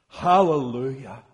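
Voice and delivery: a loud, ringing Hallelujah!, falling at the end; shouted hallelujah. Acted. Reader voicing the great multitude's shout, loud and joyful. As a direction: call out Hallelujah! like a roaring crowd.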